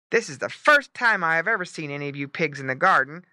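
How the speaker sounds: firm, emphatic guard dog; lightly growled scolding; stern dog. A narrator's reading. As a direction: lay down the rule as the farm dog. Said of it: "growling in his deep voice".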